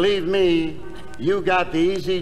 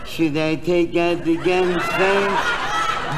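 slow, mocking repeat of a question; drawn-out sarcastic mimicry; mocking echo; 4 semitones lower, much slower than usual. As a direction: parrot it back in scornful disbelief, slowly. Mocks partner's question back.

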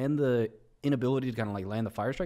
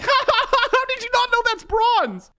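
high, loud taunting cackle; mocking viewer laugh; 7 dB louder than usual. Clone Man laughing at the streamer's bad guess, high taunting voice. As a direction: taunt him like a smug viewer. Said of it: "Clone Man's just like".

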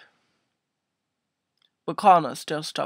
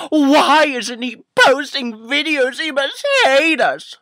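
mock fans; 6 semitones higher, 4 dB louder than usual.

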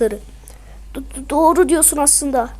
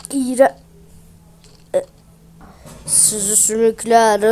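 low, softer menacing creature; evil golem; 5 semitones lower, 4 dB quieter than usual. Acted. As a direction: gloat that evil will win.

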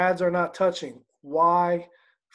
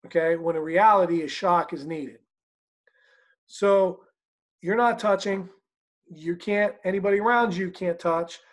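ordinary talk, nothing marked

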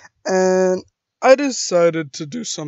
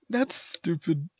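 usual speaking voice

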